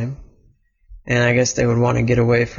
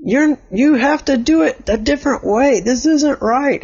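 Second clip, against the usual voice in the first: much higher, upset and insistent; upset scolding; 11 semitones higher than usual. Acted. Elliot upset at the player, high insistent scolding. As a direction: insist it is all wrong. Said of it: "Elliot was just very upset".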